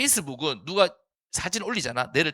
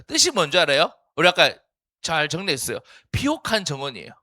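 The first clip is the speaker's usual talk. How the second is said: ordinary talk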